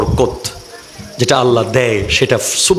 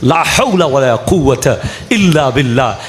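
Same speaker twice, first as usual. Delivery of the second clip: usual speaking voice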